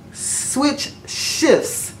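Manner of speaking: very slow, deliberate "switch shifts"; careful enunciation. Real, not acted. Vlogger retrying a tongue-tripping phrase, careful enunciation. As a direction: separate "switch" and "shifts", drawing out each word.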